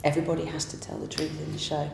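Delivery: normal talking voice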